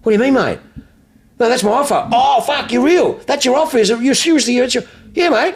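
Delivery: high-pitched haggling back and forth; mock incredulity. Dealer and caller dispute the offer, mock incredulous. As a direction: act out both sides, voice pushed right up in disbelief.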